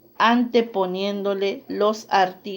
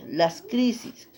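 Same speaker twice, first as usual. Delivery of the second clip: "las crisis" whispered, no voice at all; whispered echo; 4 dB quieter than usual. Shown as a demonstration. Teacher whispering "las crisis" back to the class. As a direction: breathe "las crisis" as a soft aside. Teacher repeats model word softly.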